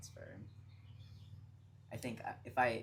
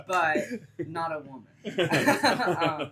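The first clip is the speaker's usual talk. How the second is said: laughing; 6 semitones higher, 16 dB louder, much slower than usual. Real, not acted. Still laughing with friends about her partner. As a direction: still giggling, words breaking with laughter.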